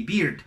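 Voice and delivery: "beard" with ee, as a mistaken bird; wrong pronunciation. Shown as a demonstration. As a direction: say "beard" with a clear ee. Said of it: "No deberíamos de decir". ("beard" con I, como error)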